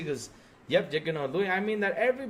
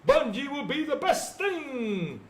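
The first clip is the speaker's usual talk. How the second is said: mocking fan voice; 9 semitones higher, 5 dB louder than usual. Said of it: "They all believe, like".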